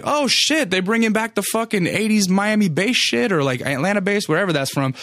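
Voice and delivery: put-on light up at an old favourite; hearing an eighties bass track outside, excited. Thrilled at hearing the song.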